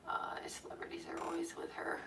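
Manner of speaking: hushed whispered aside. Real, not acted. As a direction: breathe it out as a secret. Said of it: "reading chat aside".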